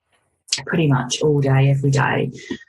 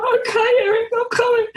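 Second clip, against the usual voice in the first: much higher, laughing reply; mock relieved reply. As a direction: squeak it out with a laugh. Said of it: "I was just like".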